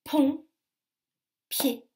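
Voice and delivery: PONT, PIED with a long pause between; separate syllables. Shown as a demonstration. Teacher helps children locate the p, syllables far apart. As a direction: punch PONT, then PIED, each alone. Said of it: "Écoutez bien".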